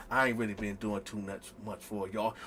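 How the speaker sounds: dropped low and soft; quiet aside. Real, not acted. To the audience, a low soft aside. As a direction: confess it to the crowd.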